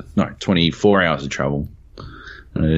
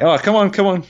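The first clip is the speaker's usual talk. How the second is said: gleeful beckoning; 13 semitones higher than usual. Retold or imitated. Waving friends through, triumphant.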